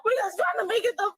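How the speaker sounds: crying out in frustration; real weepy, worked-up protest; tearful pleading. Real, not acted. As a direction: plead urgently through tears. Crying, pleading about the flight.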